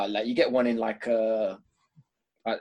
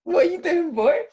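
high, slow pet-talk voice, much higher and louder; doting dog talk; 9 semitones higher, 6 dB louder, slower than usual. Real, not acted. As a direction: coo at the dog like a proud owner. Talking to his dog.

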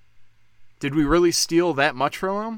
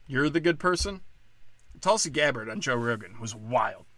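ordinary talk

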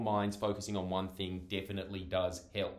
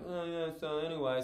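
higher, imitating a scatterbrained friend; lightly mock a friend's aimless chatter; rambling friend; 7 semitones higher than usual.

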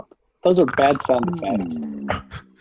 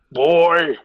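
loud, higher-pitched barbarian call; barbarian impression; 7 semitones higher, 6 dB louder than usual. Shown as a demonstration. Player showing off their best barbarian, loud impression. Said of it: "my best barbarian impression is".

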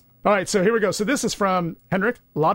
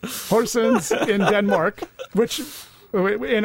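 mock Danish accent; 3 semitones higher, much slower than usual. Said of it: "You did it. I love it".

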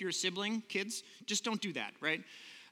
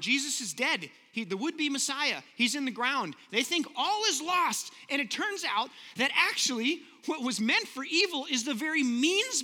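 preaching at full force; 4 semitones higher than usual. Acted. Preacher rousing congregation to resurrection hope, full force. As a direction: build to a triumphant amen.

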